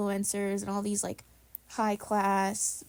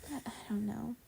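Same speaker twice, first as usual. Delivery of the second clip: whispered shrug; 8 dB quieter, faster than usual. Real, not acted. Trailing whisper after laughter.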